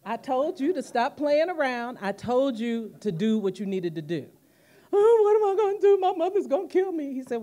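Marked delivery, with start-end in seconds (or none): 0.1-4.3 scolding. Retold or imitated. Boyfriend scolding her, retold. 4.9-7.1 loud, high, rushed comic wail; hammed-up wailing, pitched way up; mock sobbing panic. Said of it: "I'm crying, I'm sobbing".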